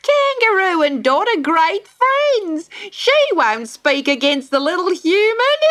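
very high, squawking bird voice; cackling kookaburra, teasing. A narrator's reading.

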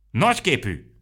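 high, loud shouted insult; shouting. A narrator's reading. Aromó shouting an insult at Mikka Makka, high loud yell. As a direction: fling the word out in a shout. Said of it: "kiáltozta Aromó".

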